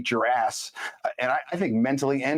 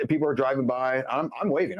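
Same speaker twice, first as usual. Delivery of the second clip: ordinary talk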